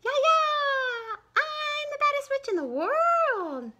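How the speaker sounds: high, loud, slow gleeful shout; high shrieking witch boast; witch taunt. Acted. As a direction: yell it like a kid playing the baddest witch.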